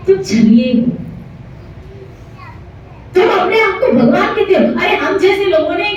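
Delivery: high-pitched scolding, acted indignation; angry accusation. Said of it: "चिल्ला रहा है". (क्रोध में ऊँचे स्वर में उलाहना)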